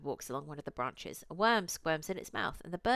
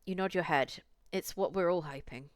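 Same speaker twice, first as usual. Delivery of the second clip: ordinary talk, nothing marked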